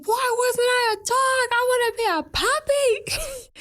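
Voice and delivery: high, drawn-out wail imitating a kid; mock childish scream. Retelling childhood screams at mom about not being a dog, mock screaming. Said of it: "like, scream at my mom".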